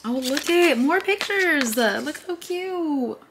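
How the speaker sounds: high, slow, doting coo. Real, not acted. Showing viewers cute family pictures, high slow coo. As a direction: melt over the cute photos. Cooing over old photos.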